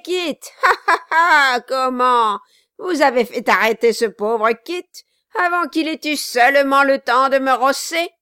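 loud, high jeering voice; mocking dwarf. Quilp jeers at Kit in the carriage, loud mocking. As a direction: taunt the prisoner with glee.

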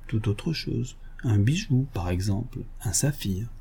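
hushed reveal. Acted. Lupin's teasing hush before reveal.

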